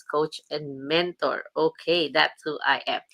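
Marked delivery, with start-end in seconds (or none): none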